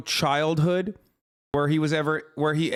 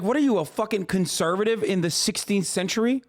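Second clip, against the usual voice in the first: ordinary talk, nothing marked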